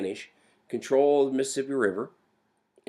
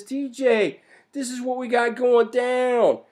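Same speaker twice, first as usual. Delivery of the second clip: urgent report; 8 semitones higher than usual. Acted. Monroe reporting back to Jefferson.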